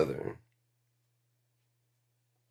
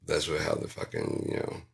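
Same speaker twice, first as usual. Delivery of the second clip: ordinary talk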